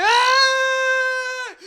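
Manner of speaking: loud high anguished wail; imitating a friend's wail; mock wailing cry. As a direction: let out a hammed-up sobbing cry. Brittany crying while being pulled.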